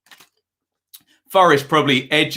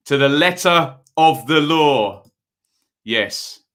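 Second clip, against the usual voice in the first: lightly put-on stern police chief; a mild imitation of a stern superintendent; Ted Hastings tones; 2 semitones higher than usual. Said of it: "Ted Hastings tones".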